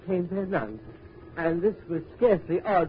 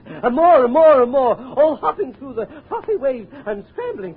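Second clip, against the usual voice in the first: rising, excited and loud; excited build; 7 semitones higher, 10 dB louder than usual. A narrator's reading. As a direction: pile up the excitement.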